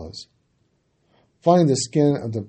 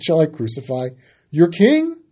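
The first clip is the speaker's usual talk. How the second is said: Pilate asking; 4 semitones higher, 6 dB louder than usual. A narrator's reading. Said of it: "Pilate said".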